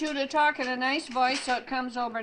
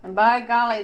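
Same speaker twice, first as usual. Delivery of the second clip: loud, indignant exclamation; emphatic complaint; 8 dB louder than usual. Real, not acted. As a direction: exclaim it in dismay. Grandma complaining about language.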